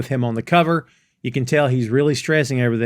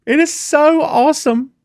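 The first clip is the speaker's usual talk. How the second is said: high-pitched, louder hammed-up happy weeping; mock bawling; 5 dB louder than usual. Friend imitates Keely congratulated through tears, mock bawling. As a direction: wail it like a comic happy cry. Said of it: "and they are bawling".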